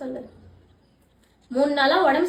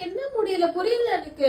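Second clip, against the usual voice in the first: crying; 3 semitones higher than usual. Real, not acted. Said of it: "இந்த மாதிரி அழுவாத".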